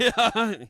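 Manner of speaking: loud, high sung Sega jingle. Retold or imitated. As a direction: belt Sega like the startup jingle.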